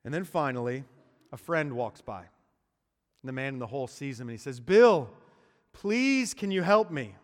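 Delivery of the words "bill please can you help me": loud, much higher pleading call; desperate plea. Acted. Man in the hole begs his friend Bill, loud pleading. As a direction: beg your friend to help you out.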